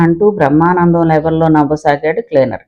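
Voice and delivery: usual speaking voice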